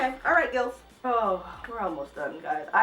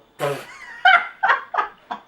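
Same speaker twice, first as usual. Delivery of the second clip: shrieking laugh, much higher and louder; high burst of laughter; 9 dB louder than usual. Real, not acted. Vlogger cracking up at a bad sex scene, shrieking laugh.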